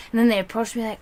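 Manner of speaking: ordinary talk, nothing marked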